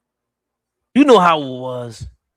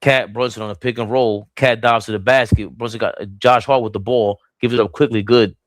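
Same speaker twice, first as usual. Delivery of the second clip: ordinary talk, nothing marked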